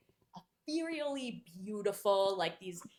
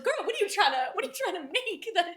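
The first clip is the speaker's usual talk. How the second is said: high, fast, exasperated demand; incredulous; 9 semitones higher, 4 dB louder, much faster than usual. Acted. Reader to heroine about bad needlepoint, incredulous. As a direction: rattle it off in mock disbelief. Host scolding the character, incredulous.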